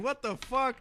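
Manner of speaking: high, incredulous what-the; disgusted shock at the pillow smell. Retold or imitated. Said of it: "I was like".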